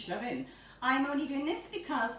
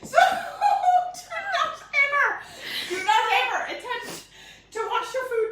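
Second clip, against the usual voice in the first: laughing; 12 semitones higher, 7 dB louder than usual. Real, not acted. Still recovering from laughing fit.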